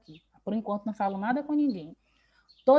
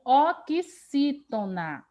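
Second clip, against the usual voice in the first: "oxítona" drawn out syllable by syllable; slow, emphatic term; 2 semitones higher, much slower than usual. Shown as a demonstration. Teacher classifying the word for the class, slow and emphatic. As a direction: stretch each syllable of "oxítona" slowly. Teacher spells out the category name.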